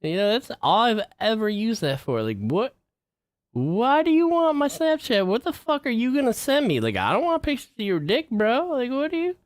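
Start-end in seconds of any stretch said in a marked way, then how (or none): 2.2-9.4 incredulous rant. Real, not acted. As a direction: demand it in disbelief, pitch way up. Ranting at friend about Snapchat.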